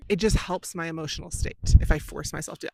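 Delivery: ordinary talk, nothing marked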